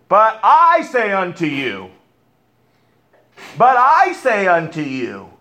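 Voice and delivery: "I" lifted and slowed; stress on I. Retold or imitated. Preacher quoting Jesus, stressing I slowly. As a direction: lean on the word "I" slowly. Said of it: "He says that over and over".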